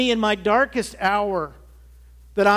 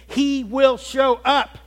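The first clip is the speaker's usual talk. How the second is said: "he will show up" stretched slow and loud, higher; slow emphatic promise; 6 semitones higher, 5 dB louder, much slower than usual. Real, not acted. Preacher promising desperate listeners, slow and loud. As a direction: drag every word out for the congregation.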